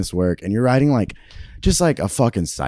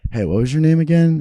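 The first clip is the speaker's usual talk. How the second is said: higher, bright mock question from a passing crew kid; casual crew guy; 10 semitones higher, 4 dB louder than usual. Actor retelling how a PA on set asked his name. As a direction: play the on-set PA asking offhand.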